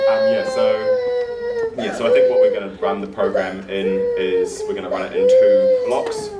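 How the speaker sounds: loud, high laughing question. Real, not acted. Host asks a guest if they're all right, laughing. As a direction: ask if they're ok while cracking up.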